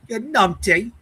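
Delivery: put-on high, loud Irish-accented jibe; mock Irish accent. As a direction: throw it out in an exaggerated Irish accent. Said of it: "in that Irish accent".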